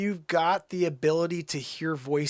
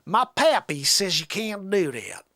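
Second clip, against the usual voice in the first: loud, high, hammed-up Southern twang; Southern accent; 5 semitones higher, 3 dB louder than usual. Country fellow complaining about attitude, Southern accent. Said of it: "never really done a Southern accent before".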